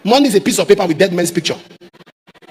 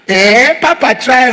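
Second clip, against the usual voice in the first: drawn-out, higher mock praise from passers-by; slow, raised imitation of a passer-by cheering; 4 semitones higher, 6 dB louder, much slower than usual. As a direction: stretch it out like a flattering onlooker.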